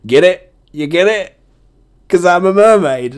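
cheesy joke-teller pushing the punchline; goofy punchline nudge. Acted. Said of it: "When you gotta say it ... you're there going".

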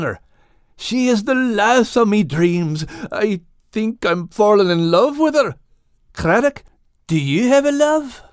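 Scottish lad telling the whale he's in love; Max's Scottish voice, smitten. Acted. Max confesses love for Kate.

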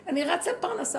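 a busy man brushing off the question; hurried man. Retold or imitated. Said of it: "אז הוא אומר".

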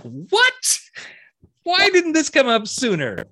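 playfully acting stunned at the news; surprised. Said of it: "And I'm like".

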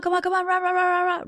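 high, frantic hurrying gibberish; rapid-fire nagging that blurs into rah-rah; crazy rush tone. Retold or imitated. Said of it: "that crazy rush tone".

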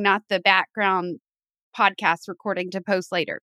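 ordinary delivery

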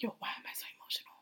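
self-talk whispered under breath. Retold or imitated. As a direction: hurry it out as a whispered worry. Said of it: "And then I'd be like".

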